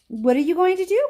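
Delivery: high, loud alarmed question; alarmed cry. A narrator's reading. Charlie alarmed as Wonka hovers over the roof. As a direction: panic about what comes next. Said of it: "cried Charlie".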